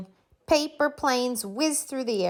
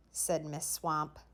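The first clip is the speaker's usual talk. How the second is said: ordinary delivery, nothing marked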